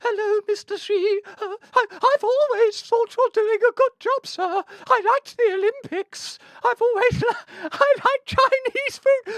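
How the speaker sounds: high, slow, nervous grovelling; nervous fawning. Pretend. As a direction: fumble flattery to a leader. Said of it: "laughing about Tucker's manners".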